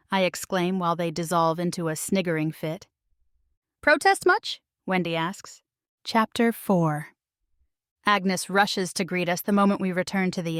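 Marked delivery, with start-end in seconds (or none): 3.8-4.6 teasing. A narrator's reading. Teasing friend.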